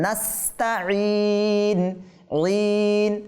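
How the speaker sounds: নাস্তাইন with a sharp audible স and a firm ain; slow careful নাস্তাইন; clear seen and ain. Shown as a demonstration. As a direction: stress the hiss of স in নাস্তাইন. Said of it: "সিনটাকে স্পষ্ট করতে হবে".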